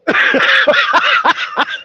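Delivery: high laugh that rises; real laugh. Real, not acted. After the Godzilla punchline, a high rising laugh. Laughing at his own story.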